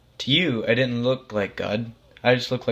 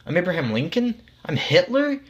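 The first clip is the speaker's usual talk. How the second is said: appalled; 8 semitones higher than usual. A narrator's reading. The dead man learns he was Hitler, appalled. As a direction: climb into horror by the second name. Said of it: "you said, appalled".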